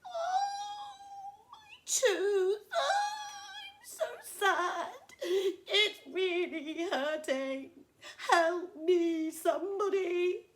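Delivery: very high, loud hammed-up wailing; howling. Crocodile with toothache howling for help, high and wailing. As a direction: wail it out in mock agony. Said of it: "he began to howl".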